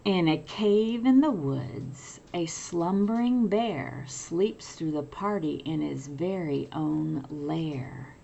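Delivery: lively picture-book read-aloud; reading to children. A narrator's reading. Teacher reading a picture book to children, lively. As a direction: read it like bedtime for little ones.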